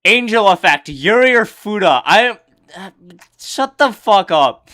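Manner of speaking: half-joking loud brush-off of a dumb question; loud reading. Real, not acted. Loudly reads a question, then snaps.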